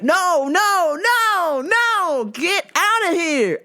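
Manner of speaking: high, insistent cries of refusal; boss's mock yelling. Boss shooing the squatting woman, mock yelling. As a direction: shoo her off with rising, high-pitched noes. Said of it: "My mans was yelling".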